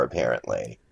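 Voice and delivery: fading into a soft murmur; trailing off. Real, not acted. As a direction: let the word drop away quietly. Trailing end of ghost story.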